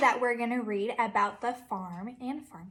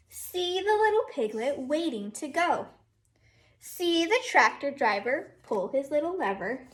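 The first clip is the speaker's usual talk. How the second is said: lively sing-song storybook read, a bit louder; teacher reading Down by the Barn to her class, sing-song; reading to children; 3 semitones higher, 5 dB louder than usual. Acted. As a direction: read it like a storybook for little kids. Reads picture book aloud.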